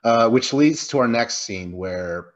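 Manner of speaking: ordinary delivery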